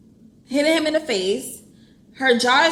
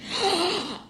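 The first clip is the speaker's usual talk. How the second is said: quick shocked intake of breath; startled gasp; sharp gasp; 7 semitones higher, 4 dB quieter than usual. Real, not acted. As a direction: gasp at the twist. Shocked by reading.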